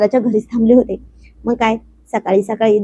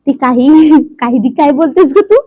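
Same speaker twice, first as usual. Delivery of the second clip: chuckling through the words, louder; laughing reply; 4 semitones higher, 5 dB louder than usual. A narrator's reading. Husband laughs at his wife's teasing, chuckling and a bit louder. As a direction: laugh off her cheeky remark. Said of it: "तसा तो हसला आणि म्हणाला".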